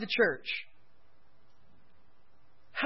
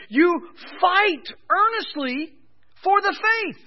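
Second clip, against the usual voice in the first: fervent preaching; 7 semitones higher, 6 dB louder, slower than usual. Real, not acted. Preacher urges fighting for faith.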